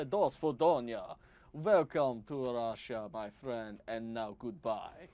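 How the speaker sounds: put-on slow, heavy Russian villain accent; a friendly Russian greeting delivered slowly in a Russian accent. GM voicing a Russian NPC before he shoots you, slow Russian accent. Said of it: "the Russian character who wants to say".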